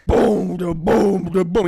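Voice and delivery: loud booming stomp sounds; stomping footsteps. Retold or imitated. Describing a huge girl walking in, loud stomping beats. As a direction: boom out each heavy footstep.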